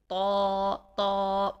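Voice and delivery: تو with a thick, heavy ط, loud. Shown as a demonstration. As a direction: say تو with a full, heavy ط. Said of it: "تو کو ہم موٹا رکھیں گے".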